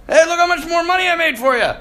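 high, quick mock boast. Imagined servant brags to his master, mock boasting. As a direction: show off eagerly to the master.

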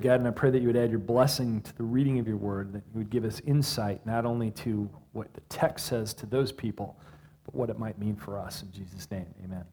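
prayer. Acted. Pastor praying for insight, soft and low. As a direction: pray quietly over the reading. Short prayer after the reading.

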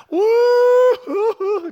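loud, high mock sobbing; imitated congregational wail. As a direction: wail like a whole crowd breaking down. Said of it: "just sobbing".

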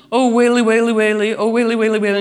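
high, loud mock wail; pretend wailing. Owner fakes complaining to tease her dog Stella, high mock wail. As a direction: ham up a whiny lament. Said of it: "I would sometimes pretend to complain".

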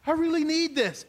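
ordinary delivery

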